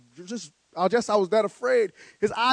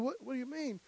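much softer, barely voiced disbelief; stunned hush; 4 semitones higher, 15 dB quieter than usual. Rookie hears the coach's call, stunned hush. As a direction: let it slip out under the breath.

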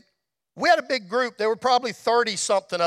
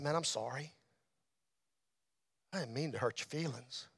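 very low, hushed apology; gentle, near-whispered apology; retold whisper; 8 semitones lower, 16 dB quieter than usual. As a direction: murmur it softly into a friend's ear. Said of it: "I whispered in his ear".

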